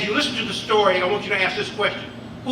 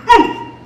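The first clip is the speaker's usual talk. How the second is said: high, loud yelp of ooh; a startled cry from behind the bushes; loud pained yelp; sharp ooh; 10 semitones higher, 9 dB louder than usual. Acted. John reacts to the anvil drop.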